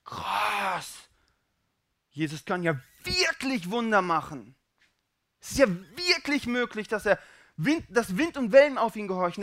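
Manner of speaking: excited, high-pitched wonder, building; stunned first, then rising excitement; amazed realization. Acted. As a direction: marvel as it dawns that miracles are real. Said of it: "dass du guckst und nur noch sagen".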